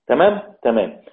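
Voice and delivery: usual speaking voice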